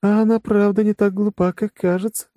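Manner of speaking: high woman's voice, a touch surprised; mildly surprised. A narrator's reading. Woman remarking on the blonde's wits, mildly surprised. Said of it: "удивлённо отметила Аста".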